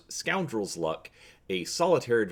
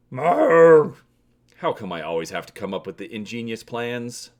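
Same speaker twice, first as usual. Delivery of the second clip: hammy stage actor; 8 dB louder than usual. Acted. Han exasperated complaint.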